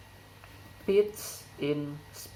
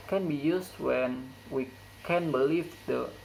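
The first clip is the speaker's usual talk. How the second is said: normal talking voice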